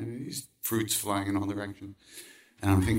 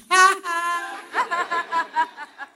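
much higher, louder voiceless laughing; breathless laughter; 8 dB louder than usual. Real, not acted. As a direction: wheeze with laughter. Laughing at his own story.

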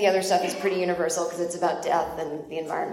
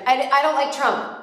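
raised, louder quick blurt; a half-laughing nervous confession, raised and louder; 6 semitones higher, 5 dB louder than usual. Retold or imitated.

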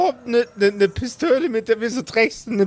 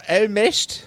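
mock Bavarian "Allmächt" with a rising tone; a quick Bavarian "Allmächt" borrowed from a friend; imitating Bavarian. Teasing the friend with his own Bavarian word. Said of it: "Wie sagst du immer".

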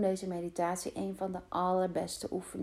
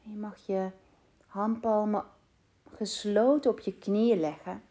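guided meditation. Acted. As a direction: guide them calmly into rest. Leads a meditation. (rustige meditatiestem)

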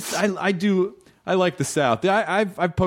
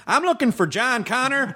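Southern Terminator; 11 semitones higher than usual. Retold or imitated. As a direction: play the Terminator as a high-voiced Southerner. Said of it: "if you were like".

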